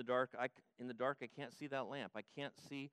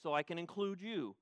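rising rebuke; 4 semitones higher, 7 dB louder than usual. Acted. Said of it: "But now he includes".

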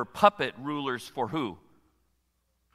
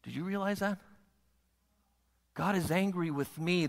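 ordinary talk, nothing marked

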